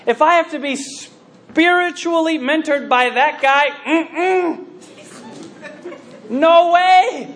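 high, mock-indignant balking; indignant refusal. Believer told to accept that mentor, flatly refusing. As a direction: build to a loud, high no.

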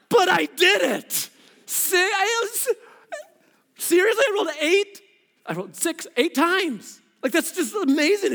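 high whining protest; whiny insistence. Retold or imitated. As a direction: plead for credit. Insisting to wife he did it.

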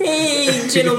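high, sing-song campy greeting; campy drag queen. Retold or imitated. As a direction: mimic a drag queen's hello. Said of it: "sounded like you were in a drag club".

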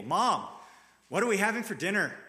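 put-on grumpy kid flopping into his chair at the table, annoyed. Shown as a demonstration. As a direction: demand it like a sulky kid who is fed up waiting. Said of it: "Grumpy kid slams himself down into his chair".

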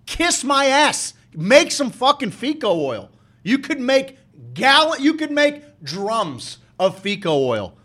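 an angry tirade stretched out word by word; heated rant. Real, not acted. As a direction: lay into them, slow and pointed. Ranting at the company.

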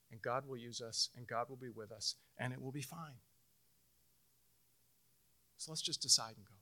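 a hint of a wise wife calming him; wife's calm counsel. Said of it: "She said".

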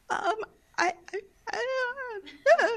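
tiny, soft, high stammering; scared stammer. Retold or imitated. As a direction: imitate a frightened kid lost for words. Scared child stammers to raging mom.

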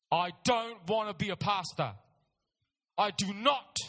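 loud, firmly punched words; emphatic refusal. Retold or imitated. Young man unwilling to be a pastor, emphatic refusal. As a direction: refuse forcefully, landing hard on each word.

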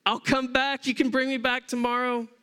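a scared man begging them to stop, lightly played; imitating the paralyzed man crying out to be taken home. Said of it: "literally crying out".

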